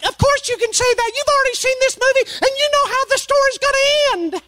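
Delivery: very high, fast put-on voice of an exasperated wife; wife's retort. Pastor voicing his wife snapping back at him on the couch, high and quick. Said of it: "And she's like".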